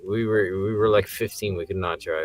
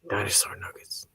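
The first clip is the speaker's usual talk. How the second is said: soft unvoiced whisper; joining the friend's spooky voices; mock whispering voice; 9 dB quieter than usual. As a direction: whisper like a voice in his head.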